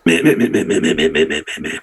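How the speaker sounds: falling, fading goblin mehs; goblin bleating meh. Acted. Goblin ticket-taker rejecting a repeated rhyme, sinking mehs. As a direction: bleat your disapproval.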